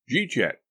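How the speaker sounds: JEET YET run together, high on JEET; slurred dialect question. Acted. Warble repeats the slurred dialect question.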